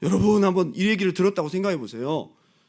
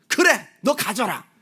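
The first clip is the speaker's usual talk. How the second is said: mock angry; 7 semitones higher, 5 dB louder, slower than usual. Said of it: "막 화를 내면".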